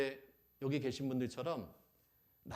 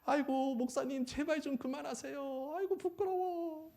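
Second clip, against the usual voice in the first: 부끄러워 애원; 12 semitones higher than usual. Acted. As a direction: beg the pastor to stop, hammed-up and high. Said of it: "아, 부끄러워가지고 막". (부끄러워서 높은 소리로 애원하듯)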